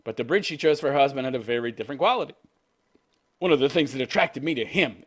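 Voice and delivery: ordinary talk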